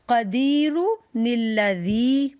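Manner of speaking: कदीरु निल्लज़ी recited very slowly, nun joined to the next word; slow Quranic recitation. Shown as a demonstration. Teacher demonstrates recitation rule to her students. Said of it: "अब हमने इसको कैसे पढ़ना है".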